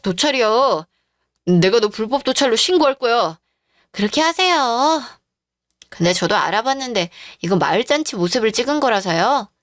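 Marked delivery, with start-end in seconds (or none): 3.9-5.0 cool taunt. Acted. Wife calmly dares him.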